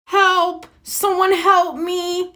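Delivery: high, frantic call for help; crying out in panic for help. A narrator's reading. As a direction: yell for help like a scared victim. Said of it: "he heard a cry".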